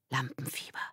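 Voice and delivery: hushed, voiceless whisper. A narrator's reading. Erik joining the imagined opening night, whispering. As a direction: whisper it very softly. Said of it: "flüsterte Erik".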